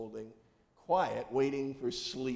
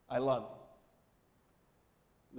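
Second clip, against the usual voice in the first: tender; much slower than usual. Retold or imitated. Bedtime words to wife.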